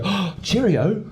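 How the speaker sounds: high, slow mock-British greeting; mock English accent. People coming up to an Englishman, hammed-up British impression. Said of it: "people just go".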